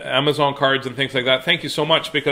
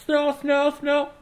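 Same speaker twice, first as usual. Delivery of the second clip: soft echo; 12 semitones higher, 3 dB quieter, slower than usual. Pretend. Echoing the announcer call.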